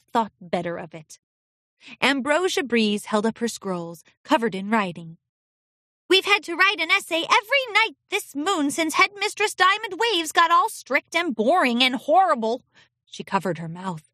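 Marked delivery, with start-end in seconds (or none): 6.1-12.6 put-on high, whiny young filly; complaining filly. A narrator's reading. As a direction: grumble about the headmistress.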